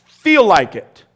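loud, slow order to yourself; firm inner command. Pretend. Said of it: "someone inside of you has to say".

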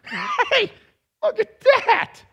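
high, loud mock-astonished shout; mock amazed. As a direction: ham up the surprise. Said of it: "Jesus didn't go ... went".

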